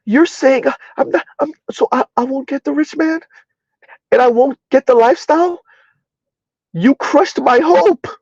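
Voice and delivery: high, whimpering mock despair; mock crushed woman. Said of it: "all they hear is".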